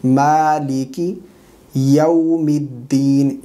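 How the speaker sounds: slow "मालिकी यौमिद्दीन" with clear i vowels; measured tajweed "मालिकी यौमिद्दीन"; correct recitation. Shown as a demonstration. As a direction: open "yau" in "यौमिद्दीन", vowels held. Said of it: "मालिकी पढ़ेंगे".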